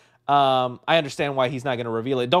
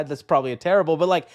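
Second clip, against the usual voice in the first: usual speaking voice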